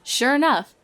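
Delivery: usual speaking voice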